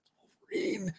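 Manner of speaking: hushed, awestruck whisper; awed whisper. Retold or imitated. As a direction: breathe it out in awe. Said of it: "we were all like".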